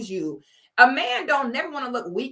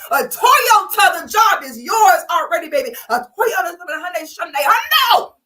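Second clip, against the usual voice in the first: loud, higher, fervent preacher cry; violent prayer; 4 semitones higher, 4 dB louder than usual. Pretend. Girlfriend praying over her jobless man, fervent and loud. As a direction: pray over him like a revival preacher. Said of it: "a violent, expressive prayer".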